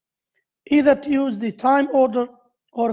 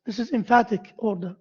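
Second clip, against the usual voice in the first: ordinary delivery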